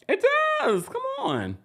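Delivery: very high mocking it does; high-pitched sassy come on; sarcastic squeal. Real, not acted. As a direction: shoot way up in a teasing squeal.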